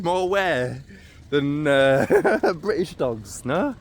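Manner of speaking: usual speaking voice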